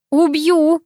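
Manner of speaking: high-pitched restrained threat; mock frantic yell. A narrator's reading. Girl carried toward the sea threatens her friend, mock yelling. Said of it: "истошно заорала я".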